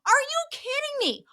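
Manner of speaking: very high, strained mock disbelief. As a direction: squeal it in disbelief. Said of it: "she's like".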